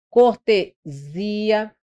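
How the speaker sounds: cortesia slowly split as corte, ZI-a; stressed syllable singled out. Shown as a demonstration. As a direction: pause before a long zi in cortesia. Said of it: "Letra B que a gente tem".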